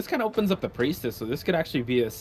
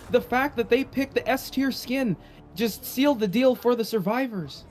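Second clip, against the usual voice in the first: Eli impression; 9 semitones higher than usual. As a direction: copy a hyped co-caster's call. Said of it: "He goes, he goes like that".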